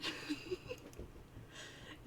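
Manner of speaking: long breathy giggle rising up; amused by the troll's attempt; real laugh. Real, not acted. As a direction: let the laugh run out. Reader laughs at own comment.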